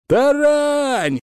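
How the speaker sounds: high, loud battle yell; shouting. A narrator's reading. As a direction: yell it at the gate. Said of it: "заорали за воротами".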